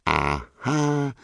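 high, hushed woman's voice fading away; triumphant high squeak, trailing off very soft; gleeful gotcha. Acted. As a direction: crow softly in a high, put-on voice. Vicky catches him in bed.

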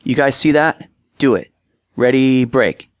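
rally the huddle, short of a full shout; mild acted yell. A narrator's reading. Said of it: "and yelled out".

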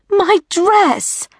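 put-on very high-pitched teenage wail; anguished, high girlish cry; distressed wail. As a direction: wail over your ruined dress. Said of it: "Emma wailed".

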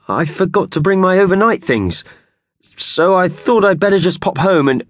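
flustered excuse. A narrator's reading. Howard making a feeble excuse to his boss, flustered. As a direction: talk your way out nervously.

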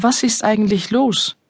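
usual speaking voice

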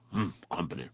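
low, quiet, grumpy grunt. A narrator's reading. Badger spotting company and turning away, grunting. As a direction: mumble it low like an unsociable badger. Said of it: "then grunted".

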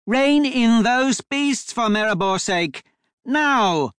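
high, drawn-out urgent yell. A narrator's reading. Duchess out of the window at the driver, high yell. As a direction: holler it slowly up to someone, pitch high. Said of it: "yelled up at the driver".

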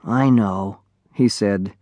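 gentle comforting. A narrator's reading. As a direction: comfort the orphaned dragon. Comforting grieving dragonling.

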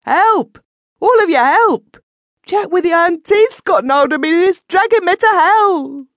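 high, loud shrieking cry for help; harsh shriek. A narrator's reading. Terrified man shrieking for help to sleeping villagers. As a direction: scream for help as he is carried into the sky. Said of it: "His voice rose in a harsh shriek".

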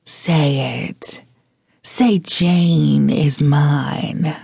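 slow, sultry whisper; breathless whisper full of promise; sultry panting. A narrator's reading. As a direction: pant it out seductively, drawing out the words. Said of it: "Keeping her tone sultry and filled with erotic promise, she panted".

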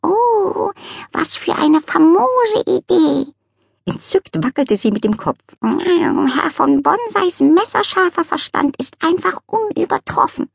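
high, slow cat voice gushing with delight; a charmed cat heaping on praise; cat swooning over the dog's idea. Acted.

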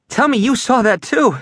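breathless, high and urgent; panting. Acted. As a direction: gasp it out, winded from running. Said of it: "panted Jeff".

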